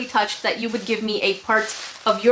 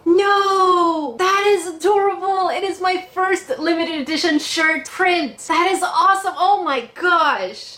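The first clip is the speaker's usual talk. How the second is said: excited shriek; 6 semitones higher than usual. Real, not acted. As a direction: burst out in delighted disbelief. Unboxing her own shirt print.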